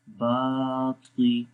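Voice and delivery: "बाती" slow, with "बा" stretched out; long baa. Shown as a demonstration. Teacher models elongation for learners, "बाती" with a long first syllable. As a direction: hold the vowel of "बा" in "बाती". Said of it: "बा को लंबा करेंगे".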